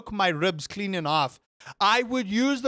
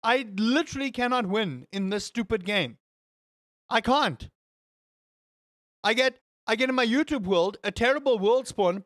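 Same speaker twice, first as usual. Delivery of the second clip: ordinary talk, nothing marked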